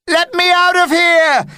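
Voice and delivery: very high, loud panicked shout. Acted. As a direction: yell to escape. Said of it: "shouted a voice".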